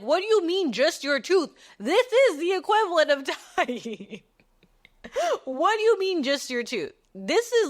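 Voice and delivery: high-pitched, dramatic outrage; hammed-up indignant squawk; indignant protest. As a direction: protest as if mortally wronged. Retold indignant protest to Kai.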